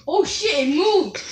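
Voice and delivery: ordinary delivery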